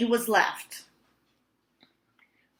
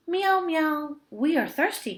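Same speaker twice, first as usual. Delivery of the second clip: high mewing cat voices; crying cats; 9 semitones higher than usual. A narrator's reading. Thirsty cats begging the old man, high and mewing. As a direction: plead like thirsty little cats. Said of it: "cried the".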